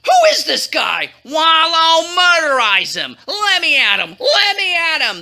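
high, blustering royal fury; shouted rage. A narrator's reading. King raging at his queen's enemy, high blustering anger. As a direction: burst out, stretching the threats. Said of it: "the king shouted, spouted off in a rage".

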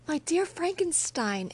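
high, loud, glad cry of greeting; joyful exclamation. Acted. Clerval spotting his friend at the inn, excited cry. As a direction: call out to an old friend with delight. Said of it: "exclaimed he".

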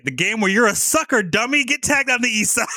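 high, singsong gloating; playful high-pitched jeer; mock taunt. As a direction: tease like a kid springing a trick, pitch climbing. Acted taunt springing the trick.

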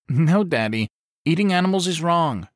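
put-on high, earnest child's voice; little girl voice. A narrator's reading. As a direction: speak like a ten-year-old girl. Young daughter answering her dad.

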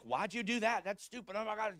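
high-pitched, incredulous protest; exasperated captain. Retold or imitated. Defensive captain scolding his quarterback for the guarantee, exasperated. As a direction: blurt it out, appalled, pitched well up. Captain's appalled reaction acted out.

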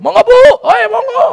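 high shouted greeting across the fields; calling out loud. Retold or imitated. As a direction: hail the farmers loudly and cheerfully. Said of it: "Wadah ibu".

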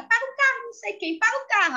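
high, urgent call to stop; excited man. Retold or imitated. Passenger telling the driver to stop, high and urgent.

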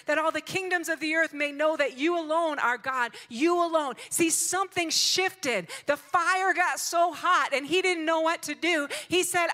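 high, loud, emphatic preacher's delivery; full-force preaching. Real, not acted. Preacher urging grace for the troubled, full-force preaching. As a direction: proclaim it to the congregation, loud and high.

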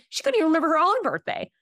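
high, fast outburst; exasperated exclamation. Retold or imitated. Niece reassures crying aunt about forgetful grandma, exasperated. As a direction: let it fly up in disbelief.

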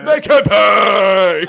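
very loud, high, long-held holler; mock shout. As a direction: stretch the final word into a hammed-up bellow. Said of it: "my brain just still instantly goes".

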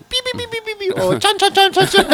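high-pitched mock Chinese syllables; squeaky cartoon gibberish imitating Chavo in Chinese. (imitación aguda del Chavo en chino)

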